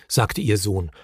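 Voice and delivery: usual speaking voice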